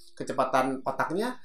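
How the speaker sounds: ordinary talk, nothing marked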